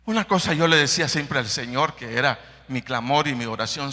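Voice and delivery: normal talking voice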